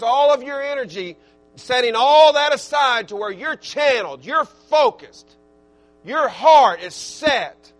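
preaching at full force. Real, not acted. Preacher urging his church to set wants aside, fervent. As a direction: drive it home to the congregation.

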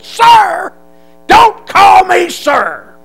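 loud, high, slow shout; a drill sergeant yelling at a recruit to stop saying sir. Retold or imitated. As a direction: stretch out the yell.

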